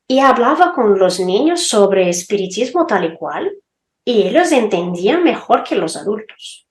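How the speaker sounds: usual speaking voice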